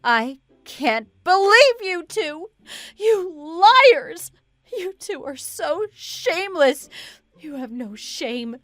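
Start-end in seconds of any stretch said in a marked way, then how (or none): 0.0-4.3 high, outraged yelling at the pair; angry screaming. Acted. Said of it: "Why are you screaming so loud?". 4.8-6.9 high-pitched outrage; angry yelling. Acted. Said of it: "Why are you screaming so loud?".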